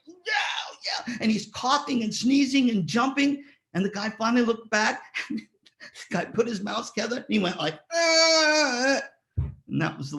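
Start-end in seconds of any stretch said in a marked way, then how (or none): 0.1-1.2 high, yelled "Yeah, yeah" cut short; hammed-up timpanist hissing a cue across the band; mock yell. Retelling the timpanist calling out to the percussionist, hammed-up high yell. 7.9-9.0 high, loud mock "Ah" cry; hammed-up shout to snap him out of it; imitated cry. Imitating the player calling to the nervous drummer, loud high cry. Player mimics the cue sound.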